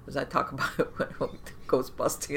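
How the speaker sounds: ordinary talk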